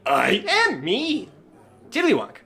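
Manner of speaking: high, dim-witted reply; simple character. Acted. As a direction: draw it out up high. GM voices simple character answering taunt.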